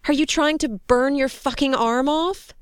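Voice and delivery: high, indignant question; shouted scolding. A narrator's reading. Soldier berating rescuer about burning his arm, shouted scolding. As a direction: scold him in alarm. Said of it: "Joni shouted".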